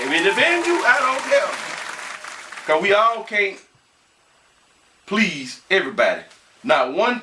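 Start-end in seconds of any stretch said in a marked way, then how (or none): 0.0-2.7 much higher, drawn-out "I don't care"; high, singsong brush-off; defiant repeat. Real, not acted. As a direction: pitch it way up and stretch out the dismissal. Defiant repeat of shirt slogan.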